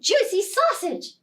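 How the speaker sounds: plain reading voice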